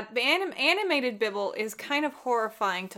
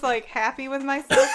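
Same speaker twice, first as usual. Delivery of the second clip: usual speaking voice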